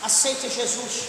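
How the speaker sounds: hushed closing altar call; earnest appeal. Real, not acted. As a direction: bring it down to a quiet, sincere plea.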